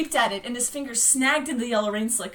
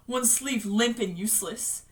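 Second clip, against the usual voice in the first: ordinary delivery, nothing marked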